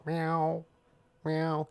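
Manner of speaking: high, loud robot-cat meows. Retold or imitated. Stuffed cat on a skateboard hitting furniture, high mock meows. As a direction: meow like a toy cat bumping a wall. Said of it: "he'll turn and go".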